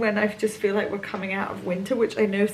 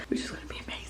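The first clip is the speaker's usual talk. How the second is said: whispering; 14 dB quieter, much faster than usual. Real, not acted. Quiet near the stables.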